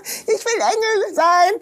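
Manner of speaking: put-on high, slow, eager child's voice; a cheeky schoolgirl claiming the angel role; bold little girl. Pupil volunteering to the teacher, high and slow eager child voice. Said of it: "Und ich, eine große Klappe".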